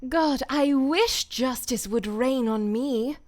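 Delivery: voicing Mercy for a recorded take, slow and earnest. Shown as a demonstration. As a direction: give it slow, weighty yearning as the healer. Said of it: "I gotta do that again".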